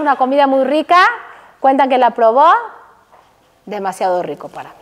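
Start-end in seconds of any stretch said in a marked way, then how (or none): 3.6-4.7 low, soft and fast aside; quiet humble refusal. Retold or imitated. Teacher voicing Baba Sali declining food, quiet. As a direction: murmur it like a humble sage.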